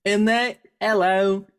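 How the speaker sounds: breezy Australian denial; hammed-up Australian accent; mock Australian accent. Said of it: "I could do Australian really well".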